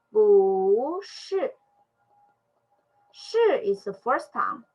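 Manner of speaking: normal talking voice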